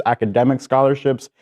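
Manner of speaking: normal talking voice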